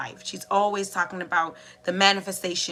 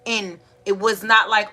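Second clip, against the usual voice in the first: ordinary delivery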